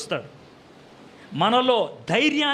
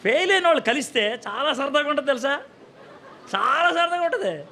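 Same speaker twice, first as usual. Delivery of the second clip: talking through real laughter; amused, words breaking into chuckles; laughing; 3 semitones higher than usual. Real, not acted. Preacher laughs with the congregation at his joke.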